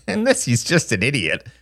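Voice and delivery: put-on snickering boy's jibe; laugh, then needle your little brother; scornful chuckle into a high-pitched taunt; mocking laugh. A narrator's reading. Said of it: "Justin laughed".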